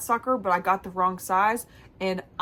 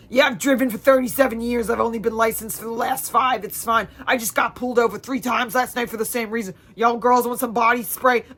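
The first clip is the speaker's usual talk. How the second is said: imitating the rambling Uber driver; a put-on driver voice, a bit louder; odd Uber driver voice; 5 dB louder than usual. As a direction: do the driver as she bragged. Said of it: "she's like".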